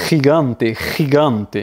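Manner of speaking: gigante opening on throaty khi, ending in té; Spanish G as guttural R. Shown as a demonstration. Teacher showing G before I, gigante said khi-gan-té. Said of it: "ça va se prononcer ri".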